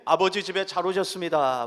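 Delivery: loud, bright welcome call to the congregation; warm loud greeting. Shown as a demonstration. Pastor has the congregation greet neighbors, loud welcome. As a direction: call out the welcome warmly and loudly. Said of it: "반갑게 인사하실 때에".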